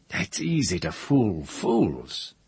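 slow, drawn-out scoffing; Kossel's unhurried contempt; scornful dismissal. A narrator's reading. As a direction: dismiss the tale slowly and scornfully. Kossel scoffs at Thar's tale.

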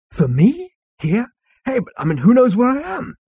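surprised. Acted. Zaphod startled by phone call.

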